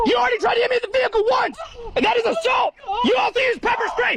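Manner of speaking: high, tearful yelling; crying shout. Real, not acted. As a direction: shout through tears to justify. Guard yells justification, crying.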